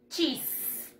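usual speaking voice

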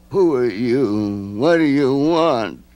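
slow, odd creature voice, scared; a timid creature startled; frightened mope. A narrator's reading. Mope startled by the children, slow and frightened. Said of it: "eyes were wide with fright".

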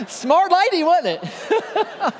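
laughing at the clever wife; laughing remark. Real, not acted. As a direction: toss it out through laughter. Right after punchline, laughing.

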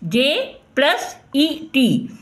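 "जे plus ईटी" very slow and drawn out; slow blending. Shown as a demonstration. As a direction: stretch every sound of "जे plus ईटी". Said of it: "जैसे यहाँ पर लिखा है". ("जे plus ईटी" बहुत धीरे बोलें)

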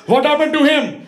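put-on loud, high Indian-accented demand; demanding grandpa. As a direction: burst out as an alarmed Indian grandpa. Grandpa demands over crying cousin.

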